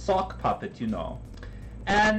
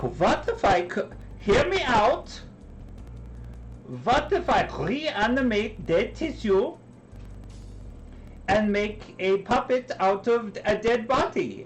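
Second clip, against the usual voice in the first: hammed-up German accent, a touch higher; mock German accent; 4 semitones higher than usual. Frankenstein begging his date to hear him out, in a mock German accent. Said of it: "I don't like your German accent".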